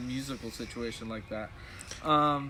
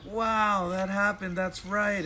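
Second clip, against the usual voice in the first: high, wondering recollection; amazed realization; 6 semitones higher, 4 dB louder, slower than usual. Retold or imitated. Musician recalling flashbacks from the jam, amazed. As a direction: relive the sudden realization, pitched up.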